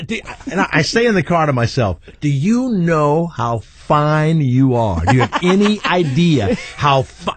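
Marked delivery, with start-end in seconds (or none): 2.2-7.3 leering compliment. Retold or imitated. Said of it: "and I say it loud in the car".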